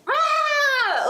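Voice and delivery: high cartoon rat scream. Describing the rat in the gif, a high cartoon shriek. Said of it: "and he's like".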